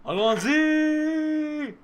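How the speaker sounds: high, very loud call across a room; drawn-out yell. Pretend. Worker yelling a customer over across the restaurant, long high shout. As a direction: holler the name, stretching it right out. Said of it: "You have to yell Alonzi over".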